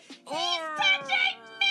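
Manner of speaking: high screaming cry, drawn out; loud high wail of alarm; panicked cry. Retold or imitated. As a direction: shriek it like a stranger just grabbed you. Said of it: "I was like".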